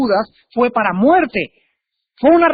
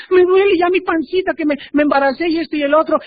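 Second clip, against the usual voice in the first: mock whining; 7 semitones higher, faster than usual. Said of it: "Y la hermana".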